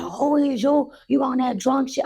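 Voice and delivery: high, challenging mock anger; retold confrontation. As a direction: square up to them, pitched high. Acted confrontation continues.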